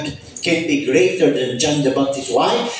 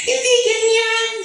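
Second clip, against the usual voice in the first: mocking critic. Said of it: "Sabi nila".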